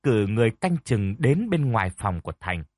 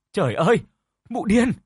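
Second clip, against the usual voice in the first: shrieked much higher and louder; panicked scream; 9 semitones higher, 4 dB louder than usual. Acted. Servant screams for the house on finding the blood, high panicked shriek. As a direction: cry out in terror at the sight of blood. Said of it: "la hét thất thanh".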